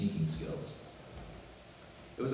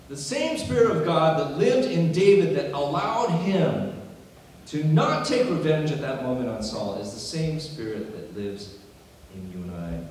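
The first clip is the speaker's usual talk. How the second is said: raised, forceful preaching that eases off; impassioned preaching; 6 semitones higher, 8 dB louder than usual. Acted. Preacher linking David's Spirit to the congregation, impassioned preaching. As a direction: start high and strong, drive the point home.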